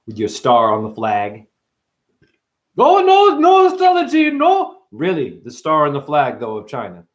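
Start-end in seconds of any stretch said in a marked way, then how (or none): 2.8-4.8 squeaked high and loud; a shrill mock protest; mock squeaky voice. Mocking an imagined critic, high and loud.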